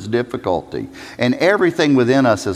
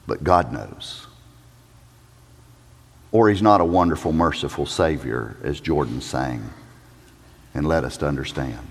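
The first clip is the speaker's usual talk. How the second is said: hushed reverence; 11 semitones lower, 5 dB quieter than usual. Real, not acted. Preacher hushing the congregation with reverence. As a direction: drop the voice to a hush.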